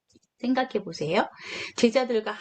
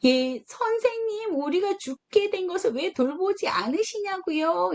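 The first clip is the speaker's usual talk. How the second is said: resentful; 8 semitones higher than usual. Retold or imitated. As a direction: blame the teacher for not caring. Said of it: "이렇게 원망하는 마음".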